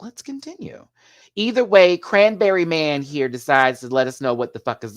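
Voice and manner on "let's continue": high, soft mock inner voice. Vlogger mimics herself moving on in a high mock voice. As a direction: squeak it out, higher and softer. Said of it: "but I'm like".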